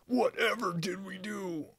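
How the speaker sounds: a small voice calling out in alarm. Acted. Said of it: "Omelette raised their voice".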